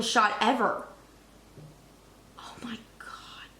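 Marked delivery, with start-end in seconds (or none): 2.4-3.5 breathless whispered oh my God; awed whisper. Real, not acted. Gazing at the opening shot, awed whisper. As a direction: whisper it, struck by beauty.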